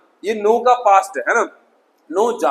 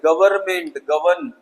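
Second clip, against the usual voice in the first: ordinary talk, nothing marked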